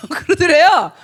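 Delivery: loud slow laugh over the words; laughing hard. Real, not acted. Mother laughing at her son's answer. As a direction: burst out laughing as you say it. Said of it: "뭐라 그랬다는 줄 아세요?".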